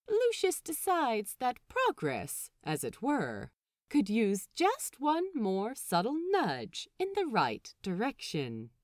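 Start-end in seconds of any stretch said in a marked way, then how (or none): none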